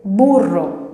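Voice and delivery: BURRO said slowly and emphatically as a Spanish model; Spanish B example. Shown as a demonstration. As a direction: model "burro" clearly for learners. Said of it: "la B de". ("burro" com pronúncia espanhola, devagar e enfático)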